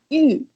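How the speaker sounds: YU as a single falling strike; fourth tone. Shown as a demonstration. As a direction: say YU in one downward stroke, like a nod. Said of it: "like, a single strike".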